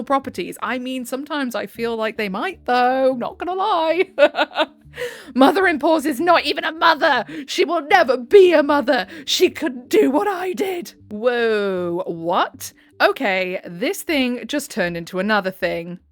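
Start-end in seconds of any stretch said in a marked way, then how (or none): 5.3-10.9 loud, higher, spiteful outburst; acted shout. Mother-in-law shouting about her son's wife, loud spiteful outburst. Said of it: "shouting to act out that glorious scene".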